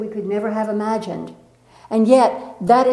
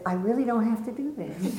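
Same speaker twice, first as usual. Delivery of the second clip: softer, hesitant self-talk with a laugh; quiet inner voice; 9 dB quieter than usual. Retold or imitated. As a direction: doubt murmured to yourself. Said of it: "a part of me that said".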